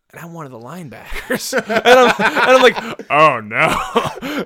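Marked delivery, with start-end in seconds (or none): 1.5-4.4 laughing panic. Retold or imitated.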